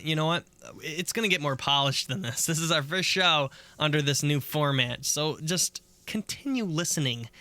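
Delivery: ordinary talk, nothing marked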